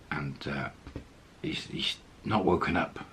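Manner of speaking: ordinary talk, nothing marked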